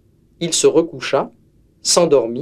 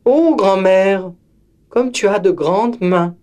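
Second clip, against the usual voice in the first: put-on higher wide-eyed child; girl wondering at grandmother's ears, higher; 4 semitones higher than usual. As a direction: marvel at the big ears. Girl questions wolf.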